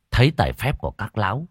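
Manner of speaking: ordinary delivery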